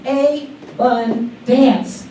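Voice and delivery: "A-bun dance" said very slowly, split into "a", "bun" and "dance"; broken into syllables. Shown as a demonstration. Preacher breaking down the word for the standing congregation, slow split syllables. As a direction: stretch "A-bun dance" apart into three slow pieces. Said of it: "let's break it down".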